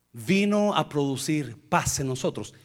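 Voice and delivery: ordinary talk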